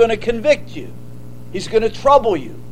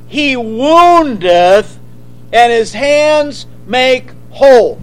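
solemn scripture reading; slower than usual. Acted. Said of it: "Now, listen to this".

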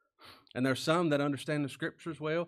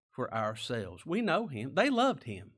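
normal talking voice

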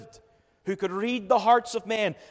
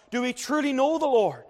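higher, louder pulpit cry; raised pleading question; 8 semitones higher, 4 dB louder than usual. Acted. Repeated question, first take raised.

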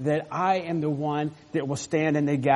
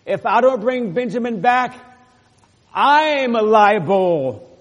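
louder, higher emphatic vow, last words drawn out very slow; Judah's vow; 6 semitones higher, 7 dB louder, slower than usual. Acted. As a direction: swear responsibility, stretching out liable. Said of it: "Judah is saying".

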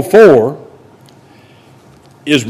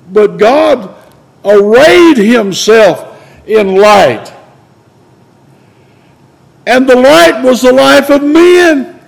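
raised, slow preaching fervor; 7 semitones higher, slower than usual. Acted. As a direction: lift it up like a revival preacher. Preaching climax, higher and slower.

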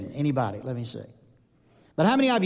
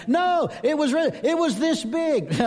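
insistent fisherman; 10 semitones higher than usual. Acted. Fisherman insists on his catch.

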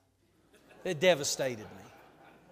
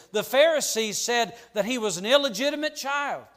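louder preaching, rising in force; a preacher building his fire; 3 semitones higher, 6 dB louder than usual. Acted. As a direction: press the list home to the congregation. Sermon building to full force.

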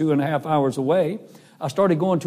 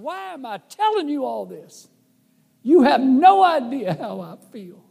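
put-on upset teen's outburst; 9 semitones higher than usual. Crying boy pours out heart.

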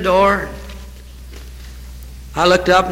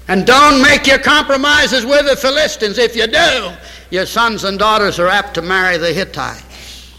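fiery warning from the pulpit; 4 semitones higher than usual. Acted. Pastor warning his church against compromise, rising preaching. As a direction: thunder the warning, then settle.

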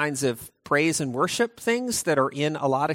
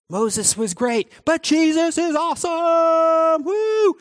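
slow, much higher and louder cheer ending in a whoop; hyped cheer; 10 semitones higher, 4 dB louder, slower than usual. Acted. Hebrews hyping Jesus over Moses, cheering. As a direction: cheer like a fan at a game. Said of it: "Hebrews is, like, saying this".

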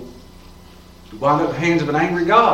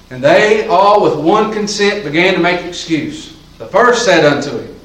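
solemn scripture reading; 3 semitones higher, 6 dB louder than usual. Acted. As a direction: lean into each word of scripture.

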